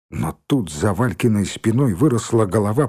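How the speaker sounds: ordinary delivery, nothing marked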